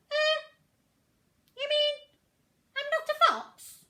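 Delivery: high, loud puzzled little voice; duckling realising he is not a fox, high and puzzled; confused duckling. Acted. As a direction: ask it in total confusion.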